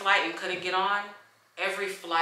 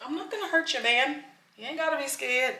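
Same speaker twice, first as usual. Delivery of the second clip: higher, gentle coaxing voice; soothing the bird; 7 semitones higher than usual. Real, not acted. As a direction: talk the bird down kindly, pitched up.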